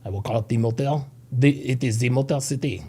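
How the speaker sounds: a quiet, playful French accent. Shown as a demonstration. Host saying Detroit for chat in French. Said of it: "say it with the French accent".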